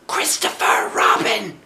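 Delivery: urgent stage whisper, high; loud whisper. Acted. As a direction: hiss his name up from the tree. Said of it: "he said in a loud whisper".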